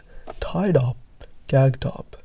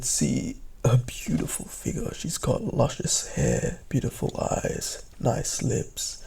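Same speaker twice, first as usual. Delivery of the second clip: breathy admiring whisper; 9 dB quieter than usual. A narrator's reading. Describing the beautiful doctor.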